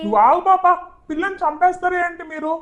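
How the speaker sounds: high, loud snap to shut up; angry shushing. Acted. Father shuts the elder daughter up, loud and high. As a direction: cut her off sharply.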